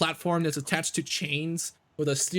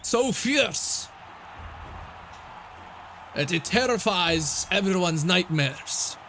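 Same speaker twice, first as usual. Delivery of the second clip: booming announcer; 5 semitones higher than usual. Acted. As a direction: boom it out to the crowd. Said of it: "he says".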